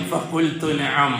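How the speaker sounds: low, very slow, calm answer; calm reply of the Prophet. Acted. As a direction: voice the Prophet's gentle reply, drawn out. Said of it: "ഞാനന്ന് മറുപടി പറയും".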